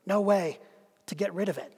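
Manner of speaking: ordinary delivery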